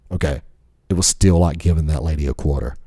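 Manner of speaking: ordinary talk, nothing marked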